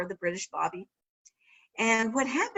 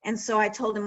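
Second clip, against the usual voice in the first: ordinary talk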